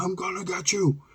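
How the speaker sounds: high, held-back imitation scream; mock scream. Angry man chasing kids, mock scream. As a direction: imitate a menacing yell without full volume. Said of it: "and scream like that".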